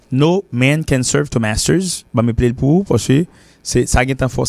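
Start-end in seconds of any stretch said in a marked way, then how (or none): 0.1-2.0 slow, careful English proverb; teacher models each word slowly for the class; slow model reading. Shown as a demonstration. Teacher to learners, slow clear demo. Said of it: "nous pas pour rien afficher".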